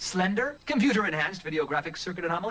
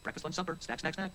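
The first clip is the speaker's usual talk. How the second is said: soft, mechanical looping chant of meals; rattled-off list; 4 semitones lower, 8 dB quieter, faster than usual. Acted. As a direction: repeat it like a stuck recording.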